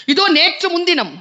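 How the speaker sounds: orator's crescendo of admiration; rapturous praise. Acted. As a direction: hail each poet with mounting awe. Exalting the poets.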